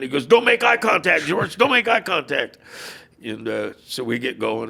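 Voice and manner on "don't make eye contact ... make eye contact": high, loud, urgent whisper-turned-yell; nervous urgent plea. Retold or imitated. Jerry begging his driver not to look at fans, high and loud. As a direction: plead with him to keep his head down, much higher and louder. Jerry hiding from deadheads in car.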